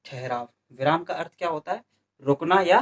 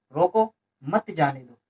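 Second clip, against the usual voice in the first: slow reading, रोको set apart from मत जाने दो; pause after रोको. Shown as a demonstration. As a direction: halt after रोको, then say मत जाने दो as one phrase. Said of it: "इसमें यहाँ विराम लेंगे". (रोको के बाद रुककर धीरे से मत जाने दो)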